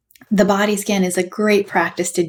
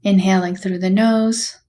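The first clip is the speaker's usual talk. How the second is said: guided meditation. Acted. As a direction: gently guide them through a body scan, unhurried. Leads a body scan meditation.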